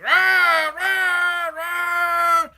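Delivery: long, high, loud monster cry; imitated movie-monster scream; three drawn-out high roars; Chooper's roar. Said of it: "this is how the Chooper sounds".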